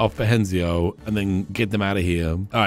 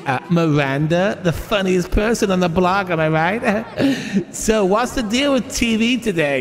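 higher-pitched, hammy comic delivery; amateur stand-up comedian; 7 semitones higher than usual. Pretend. Comedian telling an infomercial joke to the crowd, amateur stand-up patter. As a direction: play Miranda working the room. Plays Miranda doing stand-up.

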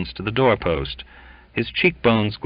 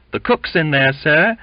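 higher, louder report; raised exclamation; exclaiming; 8 semitones higher, 5 dB louder than usual. A narrator's reading. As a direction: call it out urgently to a superior. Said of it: "exclaimed several".

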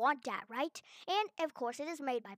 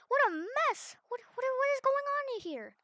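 shocked; 10 semitones higher than usual. Real, not acted. Shocked at messy room.

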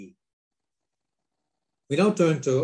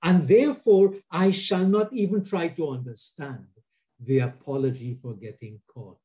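impassioned spoken-word delivery, louder on the refrains; poem reading; 3 semitones higher, 4 dB louder than usual. Acted. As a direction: read it like a declaration of faith, building on each only. Reads a poem aloud.

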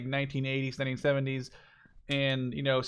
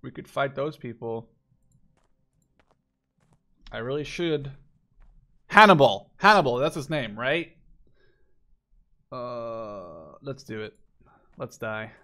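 ordinary talk

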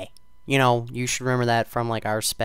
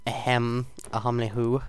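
normal talking voice

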